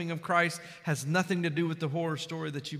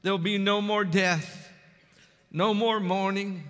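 ordinary talk

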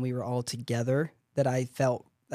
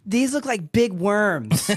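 put-on loud, drawn-out kid's blurt, pitched up; retold loud blurt; 6 semitones higher, 4 dB louder, much slower than usual. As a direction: announce it slowly and loudly, re-enacting an embarrassing outburst. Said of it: "real loudly, I said".

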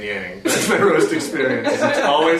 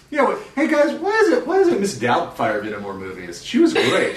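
man doing a woman's voice; 11 semitones higher than usual. Retold or imitated. Joking about Mrs Doubtfire.

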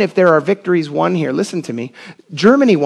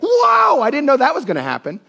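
mock astonishment; 8 semitones higher than usual. Preacher mocks the idea of God being caught out, exaggerated surprise. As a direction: play a God caught completely off guard. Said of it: "up there being like".

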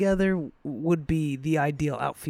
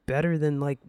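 ordinary delivery

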